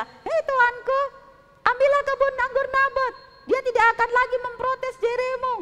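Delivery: high, loud triumphant queen; gleeful queen. Acted. Queen telling the king good news, high and gleeful. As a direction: announce the prize with glee.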